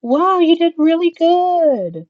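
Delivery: put-on high, loud, drawn-out toddler praise. Dad talking to a four-year-old, slow high-pitched praise. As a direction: gush as if to a small child. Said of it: "it goes a little bit higher".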